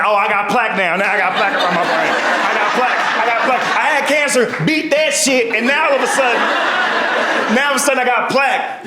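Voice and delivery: high, building to a shout; indignant mom rant. Retold or imitated. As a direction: work up to an outraged shout. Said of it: "mom responds".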